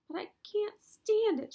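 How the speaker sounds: high, loud anguished shout. A narrator's reading. Wilbur refusing to leave Charlotte, loud high shout. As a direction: yell it out in despair. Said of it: "shouted Wilbur".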